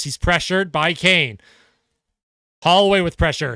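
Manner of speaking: usual speaking voice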